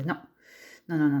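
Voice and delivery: ordinary talk, nothing marked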